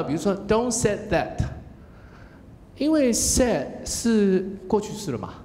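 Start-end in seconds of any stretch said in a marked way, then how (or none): 0.5-1.4 "don't said that" with a higher voice, repeating the error; quoting the mistake. Retold or imitated. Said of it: "因为我刚刚有听到".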